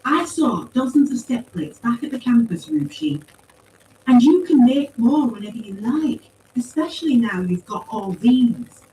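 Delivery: high, insistent character voice; insistent young protester. A narrator's reading. Paz presses Rucci about making plates, insistent protest.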